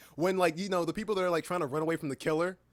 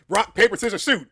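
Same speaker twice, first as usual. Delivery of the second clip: loud; 4 semitones higher, 11 dB louder than usual. Real, not acted. Frustrated rock paper scissors.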